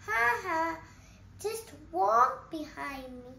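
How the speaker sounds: ordinary delivery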